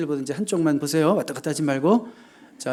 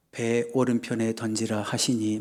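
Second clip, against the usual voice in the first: low, quiet, measured recitation; subdued liturgical reading, no shouting; scripture reading; 5 semitones lower, 6 dB quieter than usual. Shown as a demonstration. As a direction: read it slowly and evenly, like a Bible passage. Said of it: "막 이렇게 읽었죠".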